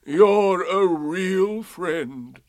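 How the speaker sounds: very slow, high, loud donkey; doleful gratitude, every word drawn out; Eeyore. A narrator's reading. Eeyore thanking Pooh, mournfully grateful. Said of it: "answered Eeyore".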